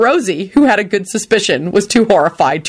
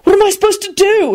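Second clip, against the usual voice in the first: high-pitched exasperated retort; heated, helpless protest; furious; 13 semitones higher, 3 dB louder than usual. A narrator's reading. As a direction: fire back angrily. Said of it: "he said furiously".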